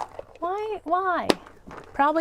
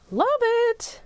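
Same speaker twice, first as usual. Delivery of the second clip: delighted; 9 semitones higher than usual. Real, not acted. Repeats her praise, higher.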